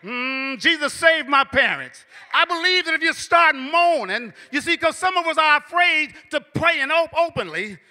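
rising, loud preaching cadence. Acted. Preacher urging congregation, loud rising sermon cadence. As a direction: preach it with building fire.

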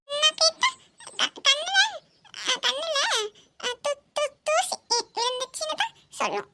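put-on high stumbling little girl voice; child voice. Niece explaining dust in her eye, high childish. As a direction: stammer like a small child. Adult voicing a small child.

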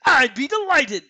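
high, loud put-on reindeer voice; goofy cartoon creature voice; Sven. A narrator's reading. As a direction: exclaim as a talking animal, high and loud. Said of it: "in Sven's voice".

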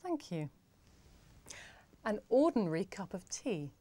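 ordinary delivery

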